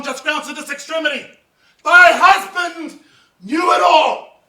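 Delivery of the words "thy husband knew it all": louder and slow, each word hammered; furious shout. Acted. Othello telling Emilia her husband knew, slow furious roar.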